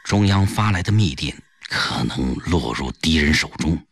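low, quick confidential report; pawnbroker comrade, serious. Acted. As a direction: speak in an urgent undertone, lifting into a suggestion.

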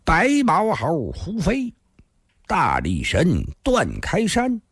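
drawn-out roll call of names; slow, deliberate. Acted. Thin man naming the doomed six, slowly and deliberately. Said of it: "缓缓地说道".

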